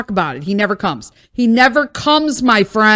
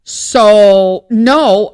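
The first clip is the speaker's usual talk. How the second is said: emphatic no; 2 semitones lower, 7 dB louder than usual. Real, not acted. Venting, refusing to leave house.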